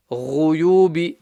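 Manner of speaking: GHUYUBI with ghain from the throat's top; guttural ghain. Shown as a demonstration. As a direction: recite GHUYUBI with a guttural gh, long vowels. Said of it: "top of the throat".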